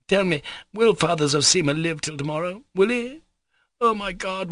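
high-pitched overwrought lament; distraught woman. Acted.

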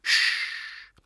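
drawn-out unvoiced hush; tearful soothing. A narrator's reading. Ol soothes Cat through his tears, gentle shushing. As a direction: soothe her with a long shush. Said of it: "He soothes her through his tears".

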